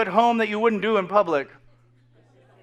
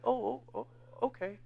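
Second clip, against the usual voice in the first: hurt dad; 17 dB quieter than usual. Retold or imitated. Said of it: "And my dad's like".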